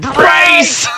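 very high, loud mock scream; hammed-up screamed impression; Nick Ricardo Avocado. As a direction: shriek it like an overdramatic meltdown. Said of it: "You sound like Nick Ricardo Avocado then saying".

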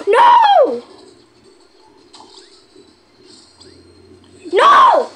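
high, loud wailing no; despairing shout. Real, not acted. Gamer losing the round, shrieking high and loud. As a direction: cry out as the run fails. Failing the level in the game.

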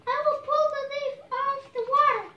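high, loud and fast; urgent shout. Acted. Bird shouting the rescue plan to the ant, high and fast. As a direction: rattle it off in a panic to help.

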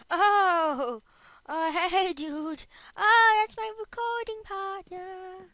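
high, loud startled greeting; surprised shout. Real, not acted. Greeting recording partner Jake, high loud surprise. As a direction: burst out in surprise.

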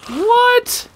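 high-pitched, incredulous yelp; shocked disbelief. Real, not acted. Gamer whose weapon just broke, shocked disbelief. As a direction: jump way up in pitch, aghast.

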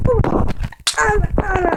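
high, loud yelps of alarm; panicked yell. Real, not acted. Gamer yelping at the enemy, high and loud. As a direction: cry out as you are hit.

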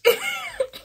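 loud, high-pitched cackle; short shrieking laugh. Real, not acted. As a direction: burst out in a squeaky laugh. Laughs at her own remark.

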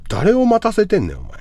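high-pitched indignant scolding; exasperated, high-pitched telling-off; impatient complaint. Real, not acted. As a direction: demand to know, voice pitched right up. Berating viewer who keeps streamer waiting.

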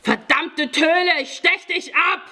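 high, loud yell of rage and pain; enraged high-pitched shout; pained, furious yell. A narrator's reading. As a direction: scream a threat through pain. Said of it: "Es war ein Schmerzensschrei".

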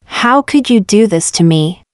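ordinary talk, nothing marked

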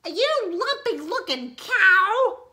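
very high, loud outraged screech; screeching witch. A narrator's reading. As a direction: screech it in the duck's face. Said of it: "screamed Gretch".

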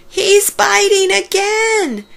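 high, slow alarmed squeal. Acted. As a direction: cry out for help as it bites. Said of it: "Piggy squealed".

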